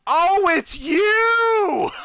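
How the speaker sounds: slow, high shouted realization with a laugh; hammed-up shriek of recognition; exaggerated recognition. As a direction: cry out in dawning recognition. Sudden recognition.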